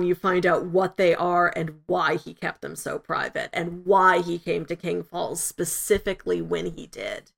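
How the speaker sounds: usual speaking voice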